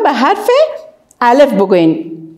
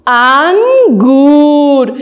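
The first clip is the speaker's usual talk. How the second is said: drawn-out model word; much slower than usual. Shown as a demonstration. Teacher sounding out a fruit name, slowly stretched. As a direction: stretch the first vowel. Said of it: "آواز چی آمد؟".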